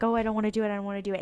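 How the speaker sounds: usual speaking voice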